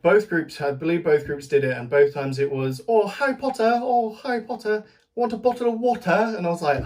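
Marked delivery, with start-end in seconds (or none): 2.9-6.3 high-pitched, hammed-up posh English accent; mock posh English accent. Fan mocking idols' taught English accent, high hammed-up posh English. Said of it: "teaching them to speak in an English accent".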